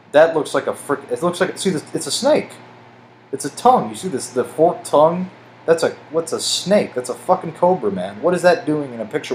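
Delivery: excited. Real, not acted. Spotting a snake in picture.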